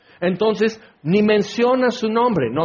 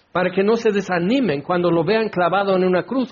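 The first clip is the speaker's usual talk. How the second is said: ordinary talk, nothing marked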